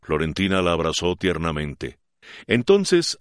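ordinary delivery, nothing marked

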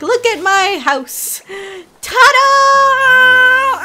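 high, slow presenting voice, ending in a loud ta-da; playful voicing of a proud little reveal; proud show-off. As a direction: show it off with a big flourish. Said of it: "It's like".

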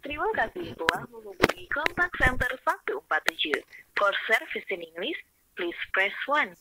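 polite automated phone menu voice; recorded hotline greeting, evenly paced; customer service voice. Acted.

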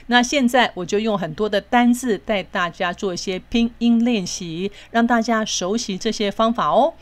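ordinary delivery

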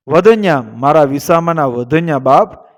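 ordinary delivery

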